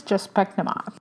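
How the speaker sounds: normal talking voice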